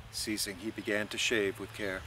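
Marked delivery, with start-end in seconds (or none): none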